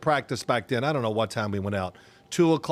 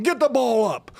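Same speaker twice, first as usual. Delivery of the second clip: high, loud barked order; mock coach yell; 10 semitones higher, 5 dB louder than usual. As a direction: coach yelling at his quarterback from the sideline. Coach yelling at practice.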